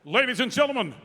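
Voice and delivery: building to a shouted winner call; booming pumped announcer. Acted. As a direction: pump up the crowd, rising shout.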